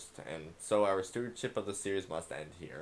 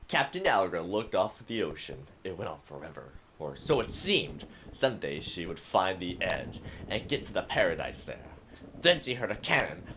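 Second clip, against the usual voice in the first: epic voice; 3 semitones higher, 11 dB louder than usual. Pretend. Gamer reads a child's pirate story aloud, loud epic voice. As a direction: boom it out like a grand pirate saga. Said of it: "do a little epic voice".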